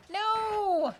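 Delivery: high sudden exclamation; surprised no. Real, not acted. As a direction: react in surprise. Reacting to super chat.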